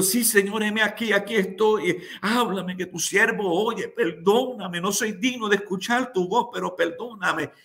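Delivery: high, urgent retold plea; fervent pleading. Unworthy servant answers the Lord, retold fervent pleading. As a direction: act out a humble servant begging, pitched well up.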